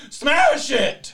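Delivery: loud, high-pitched hammed-up yell; comic full-voiced shouting; mock shouting. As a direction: shout it like a riled-up ringleader. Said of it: "just starts shouting".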